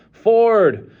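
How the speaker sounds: usual speaking voice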